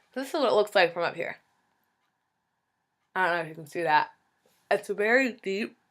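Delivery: bite splint in mouth. Real, not acted. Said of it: "I sound like my tongue is choking myself".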